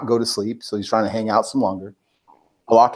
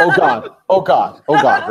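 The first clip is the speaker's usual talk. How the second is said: laughing through a mock panic; 5 semitones higher, 5 dB louder, slower than usual. Husband imitating his wife's laughing reaction to the video, laughing. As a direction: giggle out each oh God. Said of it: "I hear from her".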